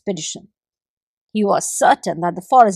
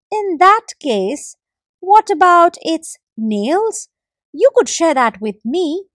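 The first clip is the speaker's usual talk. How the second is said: slow, higher wheedling gecko; gecko trying again; 6 semitones higher, 3 dB louder than usual. A narrator's reading. Gecko tries again with the squirrel, slow and higher. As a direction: drag it out, hopeful. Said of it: "the forest gecko tried again".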